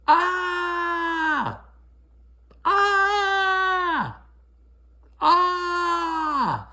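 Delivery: long high piercing shrieks; loud wailing cry; screaming. A narrator's reading. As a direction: shriek like a furious little girl. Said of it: "She started to yell and scream".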